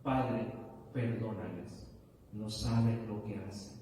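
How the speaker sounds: low, softened, measured prayer; hushed, solemn intercession; quiet plea for forgiveness. Acted. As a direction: drop low and plead gently for others. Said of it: "Él pronuncia esta palabra".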